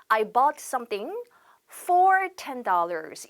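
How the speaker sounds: each word stretched out for learners; slow model sentence. Shown as a demonstration. Teacher showing students the sentence, very slow. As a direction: spell it out slowly for the class. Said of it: "보세요".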